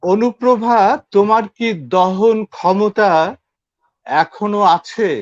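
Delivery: measured, projected verse with pauses; poetry recitation. Acted. Guest recites a Bengali poem.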